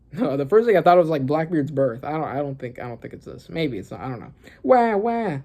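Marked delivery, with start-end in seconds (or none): 4.6-5.4 high mock baby wail; imitated baby cry. Reader voicing the newborn twins, high mock wail. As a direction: squall like a newborn, once more. Rereading baby crying panel.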